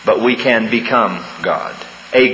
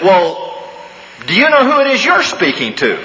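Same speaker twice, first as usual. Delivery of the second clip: much higher, cocky question flung back; cheeky comeback; 8 semitones higher than usual. Retold or imitated. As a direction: play the soldier turning the tables on the general. Cheeky soldier turns question back.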